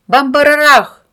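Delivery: loud, high boom sound; explosion noise. A narrator's reading. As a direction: shout a big explosion. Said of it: "хором подхватили ребята".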